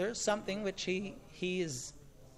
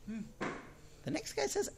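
ordinary talk

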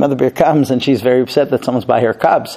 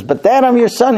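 higher-pitched, imploring son; pleading; 10 semitones higher than usual. Acted. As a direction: beg your father, pitch lifted. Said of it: "the kid is begging and pleading".

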